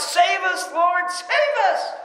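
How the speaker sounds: high pleading cry of the crowd; mock crowd cry. Preacher voicing the crowd begging to be saved, pleading cry. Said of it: "They're screaming this out".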